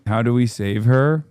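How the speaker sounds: normal talking voice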